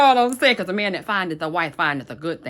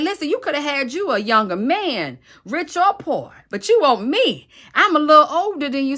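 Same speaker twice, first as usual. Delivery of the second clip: put-on flattered older man; 7 semitones higher, 5 dB louder than usual. Boaz voiced speaking to Ruth.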